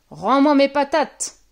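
big, angry ogre shout; roaring ogre. A narrator's reading. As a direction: roar the demand. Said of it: "rugit-il".

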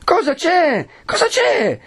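very high, urgent repeated question; insistent questioning. A narrator's reading. Agnese repeats, grabbing her arm. (domanda incalzante, acutissima)

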